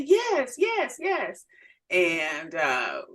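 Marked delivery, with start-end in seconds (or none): 0.0-1.5 high-pitched, eager imitation of mom; playfully imitated eagerness, pitched way up; eager mother. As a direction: jump at the offer in mom's high voice. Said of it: "And she said".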